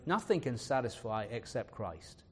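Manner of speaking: ordinary talk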